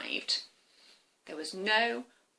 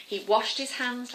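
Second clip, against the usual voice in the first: usual speaking voice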